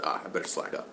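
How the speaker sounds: breathy whisper to himself; a hushed note-to-self; whispered aside. Retold or imitated. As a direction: drop it to a whisper, giving in. Retold backing down to himself.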